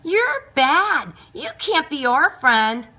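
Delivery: loud, high jeering chant of the cats; taunting call. A narrator's reading. Cats jeering at Pickles across the yard, loud taunt. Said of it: "cats who called out to Pickles".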